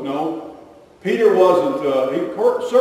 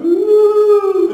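high, held, ghostly "wooo"; loud eerie wail, mocking a fortune-teller; spooky mystic "woo"; 8 dB louder than usual. Preacher mocking mystics who claim to see the future, spooky woo.

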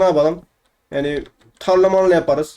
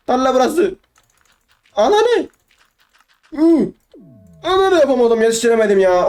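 frustrated outburst; 7 semitones higher than usual. Real, not acted. Gamer fails to finish the village in time, frustrated high outburst. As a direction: blurt it out as time runs out.

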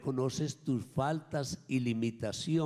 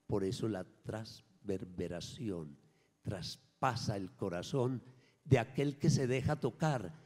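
normal talking voice

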